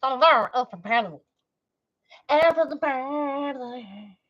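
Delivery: usual speaking voice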